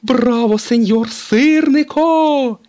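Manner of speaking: very high, loud and slow fan cheer; excited cheer. A narrator's reading. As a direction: cheer from the crowd, stretching it. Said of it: "підхопила Тіа".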